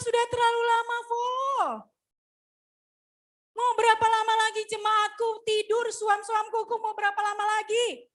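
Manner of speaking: high, sustained preaching cry; impassioned rebuke. Acted. Preacher voicing God's rebuke to a sleeping congregation, high impassioned cry.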